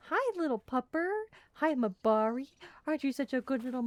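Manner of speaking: high cooing pet voice. Real, not acted. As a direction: coo up high at the little dog. Greeting the dog.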